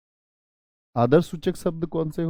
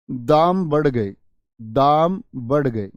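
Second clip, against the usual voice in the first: slow model phrase; 3 dB louder, much slower than usual. Shown as a demonstration. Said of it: "teacher models plural phrase".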